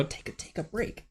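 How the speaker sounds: breathy whispered repeat. Shown as a demonstration. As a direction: whisper it to yourself.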